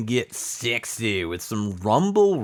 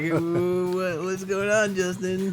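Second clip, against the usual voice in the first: high-pitched mock alarm, a bit softer; 10 semitones higher, 4 dB quieter than usual. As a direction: play it up as startled and confused. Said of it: "I was like".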